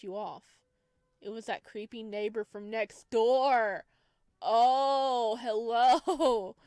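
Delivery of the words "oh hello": much louder, drawn-out flirty hello; gushing hello. Real, not acted. Player meeting the cute neighbor, loud swooning hello. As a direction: greet him like a swooning fan.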